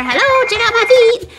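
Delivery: high, squeaky little greeting; tiny high toy voice; toy pig talking. Pretend. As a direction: play a cute squeaky animal.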